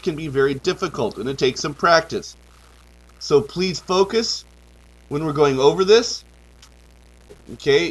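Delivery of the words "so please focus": ordinary talk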